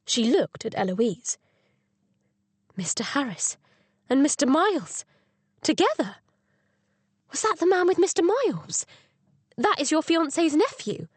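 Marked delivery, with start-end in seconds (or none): 2.8-11.1 surprised. Acted. Astonished at seeing suspects together.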